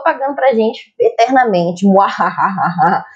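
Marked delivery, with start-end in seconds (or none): 1.9-3.0 low slow evil cackle; mustache twirling villain. Acted. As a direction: gloat like a cartoon villain. Jokes about endless debt profits.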